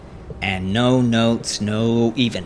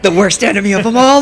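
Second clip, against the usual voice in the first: mock dramatic; 7 semitones higher, 5 dB louder, faster than usual. GM mock dramatic about cat.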